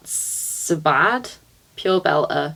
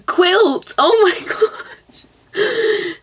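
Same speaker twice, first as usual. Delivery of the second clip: high, laughing outburst; high-pitched gasp of amusement; amused shock; 12 semitones higher, 4 dB louder, much slower than usual. Real, not acted. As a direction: react in scandalised delight. Shocked by a rude option.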